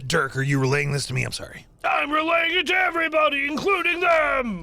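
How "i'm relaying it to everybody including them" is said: high, fast shout to everyone. Acted. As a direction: blurt it out to the whole room. Excited over-loud announcement.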